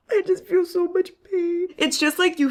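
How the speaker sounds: high, sighing lament; anguished whine. Real, not acted. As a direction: wail it high after a heavy sigh. Overwhelmed by the book, sighing.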